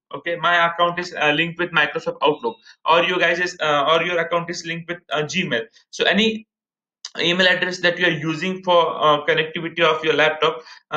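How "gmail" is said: usual speaking voice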